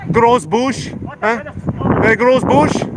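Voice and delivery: ordinary talk, nothing marked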